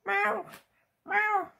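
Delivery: high mewing cat imitation; cat meow. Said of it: "Mary Jane starts saying".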